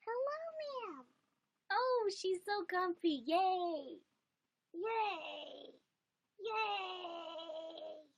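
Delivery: very high, slow squeaky greeting; high cutesy voice. Real, not acted. Streamer greets her cat, squeaky high cutesy voice. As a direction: squeal sweetly at the comfy cat.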